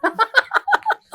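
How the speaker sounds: high laugh at his own story. Real, not acted. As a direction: break into a high, amused laugh. (ऊँची हँसी)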